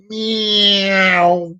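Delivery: long, drawn-out high meow; car-impression meow. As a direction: stretch a cat's meow into a car noise. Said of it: "do an impression of a car".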